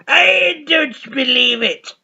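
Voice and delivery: high slow grumpy catchphrase; hammed-up I don't believe it; impression catchphrase. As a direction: drag it out like a fed-up old man. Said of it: "He goes".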